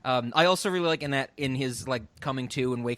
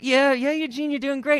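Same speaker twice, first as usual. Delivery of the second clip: high, louder imitation of a girlfriend; girlfriend's reassurance; 11 semitones higher, 4 dB louder than usual. Host voicing a girlfriend encouraging Eugene, high mock reassurance. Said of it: "And she's like".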